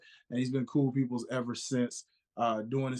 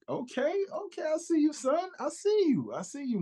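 much higher excited praise; hyped; 14 semitones higher than usual. Real, not acted. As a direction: hype up your buddy. Host hypes friend's four years.

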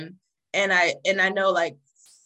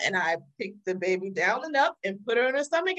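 usual speaking voice

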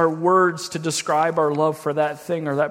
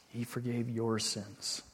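ordinary delivery

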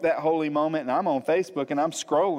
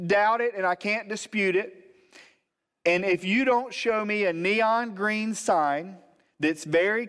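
higher, slow imitation of a wife praying; retold prayer, pitched up and deliberate; wife's demanding prayer; 5 semitones higher, slower than usual. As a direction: lay down terms to God, word by word. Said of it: "my wife was in control of the prayer".

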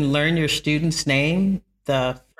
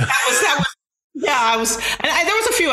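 excited; 11 semitones higher than usual. Real, not acted. Laughing at her younger self.

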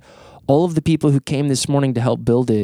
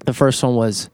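normal talking voice

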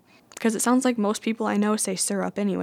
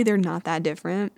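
usual speaking voice

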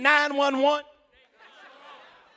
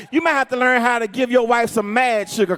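ordinary talk

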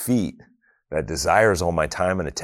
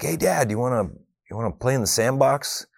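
put-on higher-pitched eager little kid asking; imitating eager kid; 7 semitones higher than usual. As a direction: play the kid tugging at dad to come play. Kids asking dad to play.

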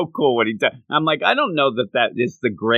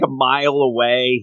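normal talking voice

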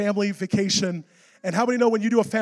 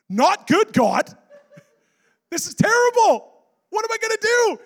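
high, loud hammed-up wail; comic panic; 12 semitones higher, 5 dB louder than usual. Preacher answering God mid-crisis, in high, exaggerated panic. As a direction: wail it in mock desperation.

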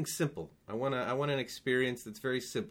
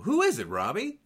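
excited; 8 semitones higher, 5 dB louder than usual. Real, not acted. Excited guest guessing.

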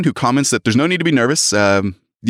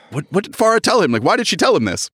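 higher-pitched flustered questioning; acted bewildered alarm; 8 semitones higher than usual. Alexis wondering what her friend told him, flustered. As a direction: play a character thrown off balance.

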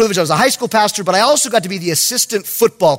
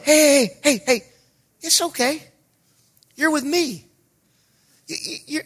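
soft, higher-pitched reassurance; calming him down; 6 semitones higher, 4 dB quieter than usual. Retold or imitated. Youth pastor to panicking kid, soft reassurance. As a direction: soothe the kid gently, with pauses. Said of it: "I'm telling him".

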